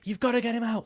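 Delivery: half-hearted mock rant, voice jumping up; mock angry creator. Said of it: "you see these creators on social media going".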